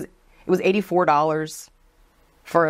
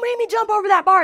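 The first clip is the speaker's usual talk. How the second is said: high, loud and fast; mock heated threat; 4 dB louder, faster than usual. Coworker threatening to jump the bar, fast high challenge. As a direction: rattle off the threat to fight. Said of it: "she's like".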